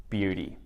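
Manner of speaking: "Beauty" as BEW-dee, soft D; flapped t in beauty; "Beauty" quiet, falling. Shown as a demonstration. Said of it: "changed that T into a D sound".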